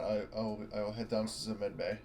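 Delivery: ordinary delivery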